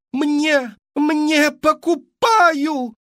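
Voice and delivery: shrill high shout, drawn out; hysterical yelling. A narrator's reading. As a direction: scream like a frantic buyer. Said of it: "истерически закричал". (истерический высокий крик)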